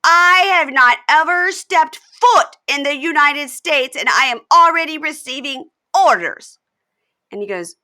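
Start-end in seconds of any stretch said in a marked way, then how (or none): 0.0-6.6 slow, louder and higher mock indignation; indignant outburst. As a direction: drag out each word, offended and loud. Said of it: "And she goes".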